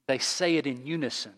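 normal talking voice

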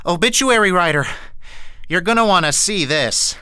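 urgent call to the obituary writer. Acted. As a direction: call him over with urgency.

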